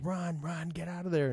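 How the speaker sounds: urgent warning. Retold or imitated. Group on the radio urging Nicole to flee, urgent high call. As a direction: hurry her out, voice pitched high.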